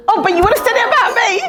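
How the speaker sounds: incredulous retort. Retold or imitated. Confronting her mum in the car.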